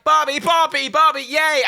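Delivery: high, gleeful cheering; excited squeal. Acted. Voicing cheerful Barbie film.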